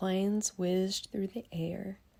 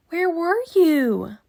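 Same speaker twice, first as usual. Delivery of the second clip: loud, much higher, slow question; eager kids; 10 semitones higher, 13 dB louder, slower than usual. Acted. Kids asking where Miss Nelson went, loud high and eager. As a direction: demand it eagerly.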